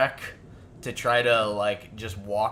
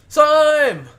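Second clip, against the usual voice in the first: yelled name; 14 dB louder than usual. Acted. GM yells for help in character.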